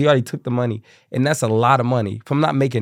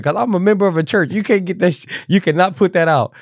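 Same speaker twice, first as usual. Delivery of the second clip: indignant church lady; 9 semitones higher, 5 dB louder than usual. Retold or imitated. Church lady protesting, voiced in story.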